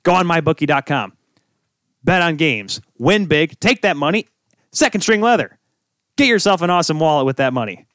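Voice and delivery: hammy ad read in short, punchy bursts; playful ad pitch. Pretend. Host pitches sponsors to listeners, playful ad pitch. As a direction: sell it like a cheesy commercial. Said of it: "Ready for some combination advertising, folks?".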